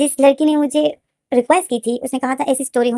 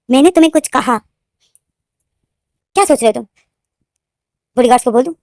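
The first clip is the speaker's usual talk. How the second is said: louder, pointed questions with long pauses; threatening demand; 6 dB louder, faster than usual. Acted. Jungkook threatening V with the bodyguards, forceful and demanding. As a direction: press for obedience, firm and raised. Acted intimidation of a captive.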